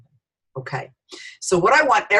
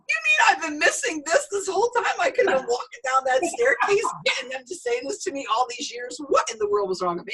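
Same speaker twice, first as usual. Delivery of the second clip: high, astonished disbelief; playful high-pitched amazement, fast at the end; amazed excitement. Retold or imitated. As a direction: gush like you just found out what you missed. Retelling her own amazed reaction.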